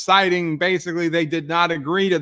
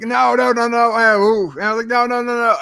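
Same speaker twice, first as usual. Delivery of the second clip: panicked no; 6 semitones higher, faster than usual. Retold or imitated.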